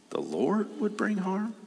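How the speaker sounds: high, incredulous question; mock disbelief. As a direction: question it in a high-pitched, puzzled voice. Feigns disbelief at the verse.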